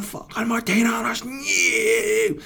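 very high, squealing yell; mock fan yell. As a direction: scream it out the window. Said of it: "People aren't flying around there going".

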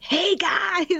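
high-pitched American pop-band greeting; mock a chirpy American stage hello; fake American accent. Singer mocking fake American stage talk, high American accent. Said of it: "singing an American accent".

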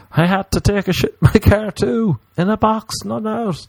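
squeaky high embarrassed confession; high mock caller voice. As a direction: pitch it way up like a flustered caller. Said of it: "be like".

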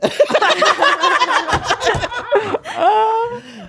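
helpless high cackle; loud high laugh. Real, not acted. Reading about still loving Anna, loud laughing fit. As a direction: break up laughing.

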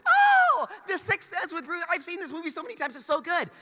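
high, loud gushing squeal on the oh; gushing girlfriend imitation. Mocking the roommate's girlfriend's gushing, high and excited. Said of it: "She's like".

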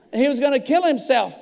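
ordinary talk

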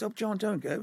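a touch of upper-class English coaxing; posh pleading. Retold or imitated. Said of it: "awfully posh".

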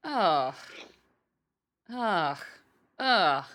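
loud, repeated "ugh" of disgust; disgusted groans. Real, not acted. Host reading a gross slang definition, groaning in disgust. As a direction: groan in revulsion, each one loud and falling.

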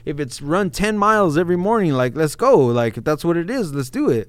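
loud and eager, ready for anything; fired up, let's go. Retold or imitated. Eager readiness to change.

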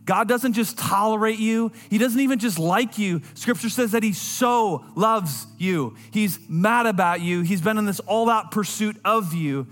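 rising sermon passion; preaching. Acted. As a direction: press the love of God home to the room. Preaches to the congregation.

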